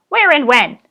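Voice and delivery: loud high barked demand. Acted. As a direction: bark it at the digger. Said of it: "barked the goblin".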